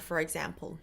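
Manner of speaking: normal talking voice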